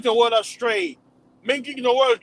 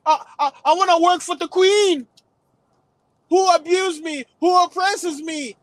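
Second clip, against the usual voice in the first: whiny complaining voice; 4 semitones higher than usual. Retold or imitated. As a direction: beg to go back like a whining slave. Voicing complaining ancestors.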